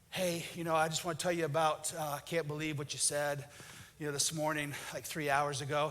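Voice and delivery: quiet, low and weary, mildly upset; a hurt wife on the phone, held in; soft wife voice. Retold or imitated. Wife calls husband at work, quietly hurt.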